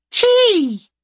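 high piercing little animal cry; shrill creature shout. Acted. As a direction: squeal the name like a small creature. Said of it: "shouted Chee".

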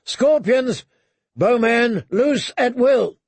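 high shouted orders, drawn out at the end; calling out. A narrator's reading. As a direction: call across the wall to the crews. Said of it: "he called".

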